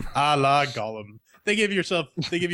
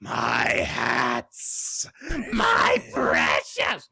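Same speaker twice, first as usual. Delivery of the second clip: Gollum impression; much slower than usual. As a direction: cry it out like a greedy creature. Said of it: "my Gollum impression".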